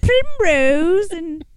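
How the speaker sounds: high put-on northern English accent; a quaint northern English village voice. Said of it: "like, a weird northern English accent".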